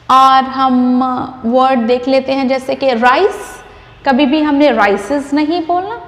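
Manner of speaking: normal talking voice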